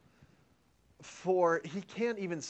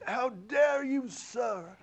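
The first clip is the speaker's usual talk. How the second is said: high, slow, outraged challenge; incensed outrage; 8 semitones higher, much slower than usual. Retold or imitated. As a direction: play the offended Southern congressman. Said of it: "So he is incensed".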